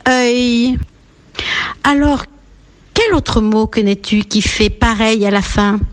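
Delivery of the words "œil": œil alone, drawn out and held; isolated final sound. Shown as a demonstration. Teacher isolates the last sound, œil held long. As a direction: stretch œil as a single sound. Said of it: "le dernier son".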